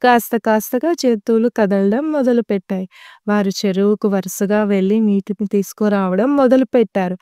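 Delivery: plain reading voice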